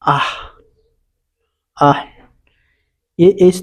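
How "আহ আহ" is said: "আহ" as an isolated open ah; model vowel sound. Shown as a demonstration. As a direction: sound out "আহ" on its own, level and clear. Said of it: "উচ্চারণ হয়".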